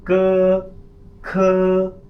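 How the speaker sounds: क then ख, high and loud, set apart; contrast demo. Shown as a demonstration. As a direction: drill क against ख for the class. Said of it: "आपने इसको पढ़ना है". (क और ख का फ़र्क ज़ोर से दिखाना)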